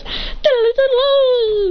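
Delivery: high, loud sung trill; music box imitation. Student mocking classmates as a music box, high loud warble. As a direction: trill up and hold a high note. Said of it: "music box that goes".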